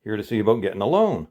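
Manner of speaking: a cheeky frog introducing himself; Kermit the frog. Acted.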